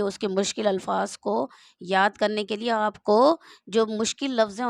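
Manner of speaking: normal talking voice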